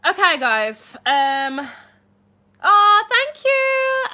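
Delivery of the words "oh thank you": very high, loud, slow thank you; gushing thanks. Real, not acted. Streamer thanking a viewer for a donation, gushing. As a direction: squeal it out, touched.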